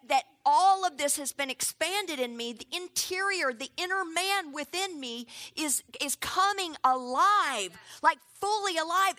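usual speaking voice

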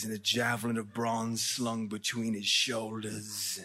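put-on low, slow, hushed British-accented reading; measured British scripture reader. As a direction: read it softly and slowly in a British accent. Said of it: "read it in a British accent".

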